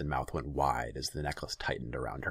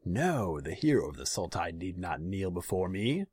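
smooth, mocking ruler; false courtesy, cold underneath; silky villainous Khan; 4 semitones higher than usual. A narrator's reading. Khan voiced in story.

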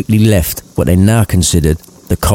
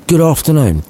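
dismissive; 8 semitones higher than usual. Acted. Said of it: "Scrooge added dismissively".

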